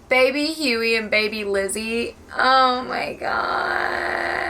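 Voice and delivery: high, slow, drawn-out wail; tearful gushing. Real, not acted. Reader aching for two innocent children, crying. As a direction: whine through real tears, stretching the words.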